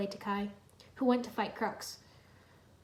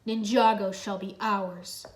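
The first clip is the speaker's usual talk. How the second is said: hiss; 2 semitones lower, 6 dB louder, much slower than usual. A narrator's reading. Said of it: "Crux hissed".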